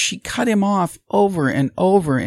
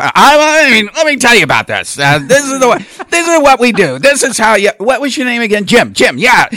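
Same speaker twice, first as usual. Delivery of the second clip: put-on higher, louder rambling old politician; Joe Biden impersonation; 6 semitones higher, 7 dB louder than usual. Mocking Biden lecturing a town hall voter, loud and high. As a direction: lecture folksy and loud, name-checking Jim. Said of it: "just finished up doing my Joe Biden impersonation".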